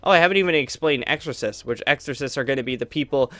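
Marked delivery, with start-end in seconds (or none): none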